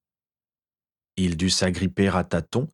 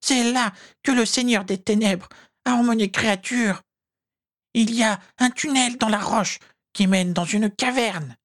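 very high, squeaky pleading elf; high-pitched begging elf voice; pleading whine; 14 semitones higher than usual. A narrator's reading. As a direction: whimper it out in a tiny, high creature voice. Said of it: "gémit Créature, la voix suppliante".

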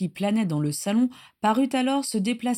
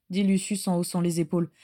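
usual speaking voice

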